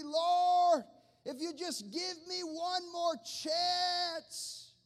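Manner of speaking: a desperate man shouting his prayer; desperate pleading prayer. Acted. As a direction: beg God at the top of your lungs. Acts a desperate bargaining prayer.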